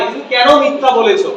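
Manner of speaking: ordinary talk, nothing marked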